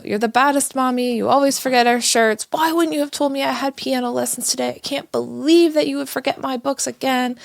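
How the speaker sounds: put-on high, loud childish whine at mom; kids yelling. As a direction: act out the angry kid in full. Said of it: "two of them in the back just start yelling at me".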